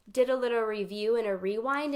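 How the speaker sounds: ordinary delivery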